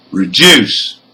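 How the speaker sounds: "reduce" stressed on DUCE, weak start; last-syllable stress. Shown as a demonstration. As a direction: lean on the end of "reduce". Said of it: "have stress on the".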